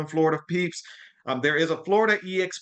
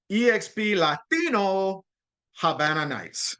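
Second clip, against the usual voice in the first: Spanish accent; 5 semitones higher, slower than usual. Shown as a demonstration. Presenter shows off a Spanish accent on the party name. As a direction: say "Habana Nights" the Spanish way, open a's and a B sound. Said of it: "you see how I did that?".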